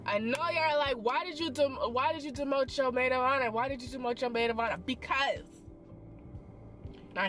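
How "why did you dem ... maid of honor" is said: ordinary talk, nothing marked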